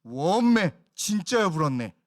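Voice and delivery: loud, exclaiming Jeolla dialect; hammed-up Jeolla regional exclamation; Jeolla dialect imitation. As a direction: mimic an amazed Jeolla speaker. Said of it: "전라도 사투리로 얘기하시는데".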